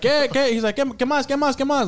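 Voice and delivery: imitated Spanish accent. Coworker imitating the yard guy for his friends, mock Spanish accent.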